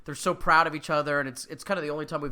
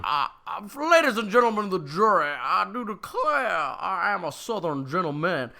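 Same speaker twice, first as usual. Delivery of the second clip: put-on southern gentleman accent; 5 semitones higher than usual. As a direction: ham up a Southern lawyer addressing the jury. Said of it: "no accent funnier".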